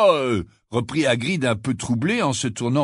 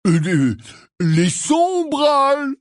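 raised voice; 10 semitones higher, slower than usual. A narrator's reading. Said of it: "répéta-t-il en haussant le ton".

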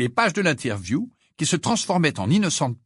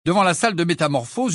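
ordinary delivery

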